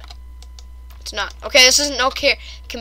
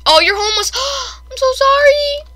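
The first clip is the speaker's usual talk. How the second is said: high squealed apology with a gasp; exaggerated pity; 13 semitones higher, 7 dB louder than usual. Exaggerated pity for homeless villager.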